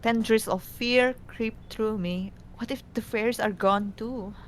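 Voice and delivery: usual speaking voice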